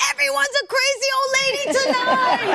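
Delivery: high, quick amused neighbor; neighbor joking. Retold or imitated. Neighbor downstairs teasing about the crazy ladies, amused. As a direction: play the laughing neighbor downstairs. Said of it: "she goes".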